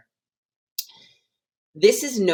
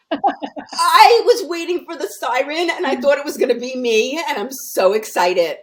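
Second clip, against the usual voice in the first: high, loud, gushing excitement; squealing with real delight; excited; 10 semitones higher, 8 dB louder than usual. Real, not acted. Phone call interrupt, excited squeal.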